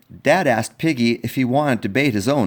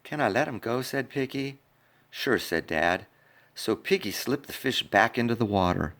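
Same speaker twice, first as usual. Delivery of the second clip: softer, gentle reading; soft sad narration; 3 semitones lower, 5 dB quieter than usual. A narrator's reading. Storyteller reading the fish scene to kids, softly. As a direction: read the sad part tenderly.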